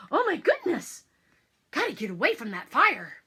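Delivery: breathless, high, fast panic; frantic. A narrator's reading. Narrator reliving the children's escape from the fire, frantic and high. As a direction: rush it like fleeing a fire.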